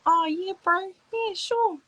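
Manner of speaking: high, bright-eyed imitation of a New Zealand Māori guy's accent; imitating a Kiwi bro's accent, high and friendly. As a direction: mimic the Aotearoa haka guy's Kiwi accent, pitched well up. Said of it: "He had, like, an accent".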